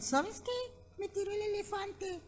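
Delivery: very high, soft little squeak; tiny ant voice. Acted. The ant complains to her friends at the anthill in a tiny squeak.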